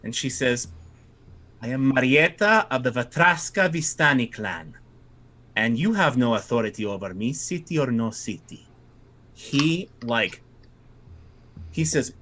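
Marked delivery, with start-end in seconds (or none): 1.6-8.7 put-on lightly accented defiant Vistani woman; a touch of a proud Vistani accent; defiant Vistani girl. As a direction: stand your ground with a hint of Vistani accent. GM voices Vistani girl Marietta.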